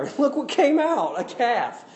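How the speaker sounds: high, loud mock wonder with a laugh; mock amazement. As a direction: act astonished at the calf. Mock amazement at golden calf.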